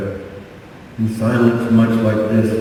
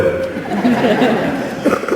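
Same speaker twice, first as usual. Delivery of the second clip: laugh; 10 semitones higher than usual. Real, not acted. Laughs at "Oh, boy.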